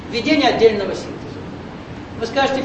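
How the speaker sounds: normal talking voice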